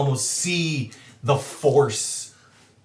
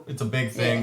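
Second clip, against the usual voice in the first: ordinary delivery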